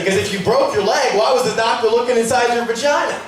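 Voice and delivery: usual speaking voice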